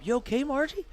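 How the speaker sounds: high, gentle worried check-in; voicing a pit boss reaching down to a fallen lady; concerned pit boss. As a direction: ask the old lady if she's alright.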